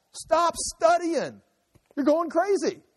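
very high, stretched-out mock cry; exasperated exclaiming. Gentile begging the scholar to stop studying, mock exasperated exclaiming. Acted Gentile reaction to Paul.

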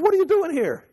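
high mock-incredulous God; God questioning. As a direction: ask it like a baffled father. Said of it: "and God says".

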